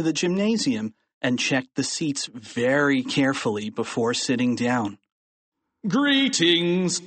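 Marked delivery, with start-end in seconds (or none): 5.9-7.0 lightly voiced principal, drawn-out higher greeting; a hint of a pompous announcer; principal's greeting. A narrator's reading. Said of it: "said Mister Krupp".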